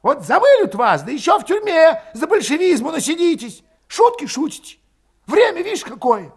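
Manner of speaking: high, loud, fuming tirade; impatient outburst. A narrator's reading. Berating hesitant comrades who won't flee, exasperated. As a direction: berate them at the top of the voice.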